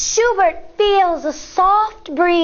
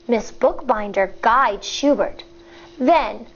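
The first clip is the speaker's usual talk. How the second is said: ordinary delivery, nothing marked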